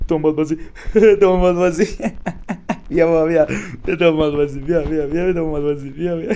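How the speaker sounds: loud, giggling playground teasing; laughing taunt. Real, not acted. Player goads the monster into chasing him, laughing taunt. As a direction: taunt through bursts of laughter.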